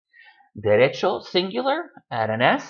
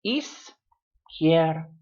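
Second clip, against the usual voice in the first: "Izquierda" broken into iz, quier, da, with clear pauses; three separate syllables; 3 semitones higher, much slower than usual. Shown as a demonstration. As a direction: say Izquierda very slowly, one syllable at a time. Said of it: "this word is only three syllables".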